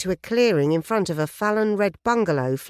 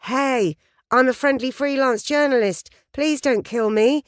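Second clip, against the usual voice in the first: higher, bright pleading innocence; a hopeful higher voice begging not to be shot; friendly plea; 8 semitones higher than usual. Retold or imitated. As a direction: sound harmless and eager to please. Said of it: "One she hoped said".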